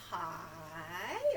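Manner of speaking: long, slow stretched word; drawn out. Shown as a demonstration. Storytime host shows children stretching, word drawn out slowly. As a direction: stretch the word out like a reach upward.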